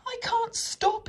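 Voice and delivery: very high, slow wail; frightened, high-pitched cry; panicked wail. A narrator's reading. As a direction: wail helplessly as if running away out of control. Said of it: "he wailed".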